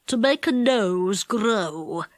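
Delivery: slow, low goblin. A narrator's reading. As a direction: speak slowly like a wise goblin. Goblin answers Saucepan.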